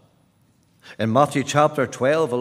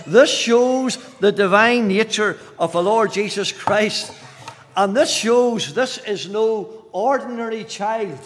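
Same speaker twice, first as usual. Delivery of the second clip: raised, ringing pulpit delivery; impassioned preaching; 9 semitones higher, 6 dB louder than usual. Real, not acted. Preacher proclaiming the Son of the Highest, impassioned. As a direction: proclaim it with mounting force.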